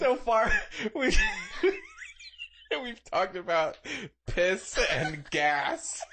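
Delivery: high, breaking with laughter; can barely get it out for laughing; laughing through words. Real, not acted. Host cracking up at their own topics, laughing through words.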